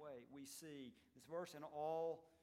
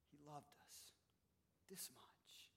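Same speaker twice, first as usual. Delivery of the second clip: soft, falling into a whisper; tender hush, much softer; 2 semitones lower, 15 dB quieter than usual. Real, not acted. Preacher softens at key point.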